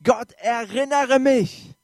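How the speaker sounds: slow, high, loud plea to God; cry to God. Acted. Preacher voicing a cry to God, loud slow plea. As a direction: cry out slowly to God, pleading. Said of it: "so Schreie zu Gott".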